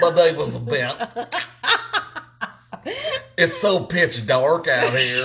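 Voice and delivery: put-on joking southern accent, laughing. Teasing partner as a child out in the cold, southern accent. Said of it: "had a southern accent".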